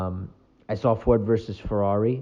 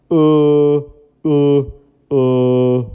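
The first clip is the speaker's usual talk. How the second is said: loud, vacant uhs; dumb-guy uh; 8 dB louder, much slower than usual. Retold or imitated. Mocking people who talk like that, loud dumb grunts. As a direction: play a brainless guy grunting. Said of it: "people that talk like that".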